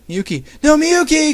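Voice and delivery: high, loud cry of the name; hammed-up anguished scream. A narrator's reading. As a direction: cry out for a lost friend. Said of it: "Kagami screamed".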